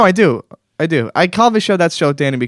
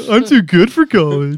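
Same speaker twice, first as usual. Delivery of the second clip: goofy voice; 4 semitones higher, 5 dB louder than usual. Pretend. Mocking voice continues.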